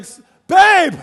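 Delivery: high, loud holler to his wife; calling out. Retold or imitated. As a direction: shout for her across the yard. Calls wife as tornado approaches.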